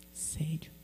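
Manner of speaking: hushed whispered reply; whispered certainty to her husband. Retold or imitated. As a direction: breathe it like a secret. Quiet confirmation to husband.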